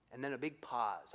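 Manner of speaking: ordinary delivery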